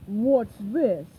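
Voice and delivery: gruff low pirate; deep pirate voice. Acted. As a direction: growl it like a pirate boss. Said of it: "a deep voice asked".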